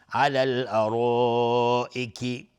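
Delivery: slow recital with a long-held vowel in الارائک; prolonged madd. Shown as a demonstration. Teacher demonstrates madd in علی الارائک, drawn-out vowel. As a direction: stretch the long vowel of الارائک for four or five beats. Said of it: "مد واجب متصل به مقدار چهار تا پنج حرکت".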